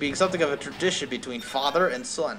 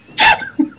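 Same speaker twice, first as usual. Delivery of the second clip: a sudden very high, loud laugh burst; 10 dB louder than usual. Real, not acted. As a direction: crack up at yourself. Cracks up at own Goofy voice.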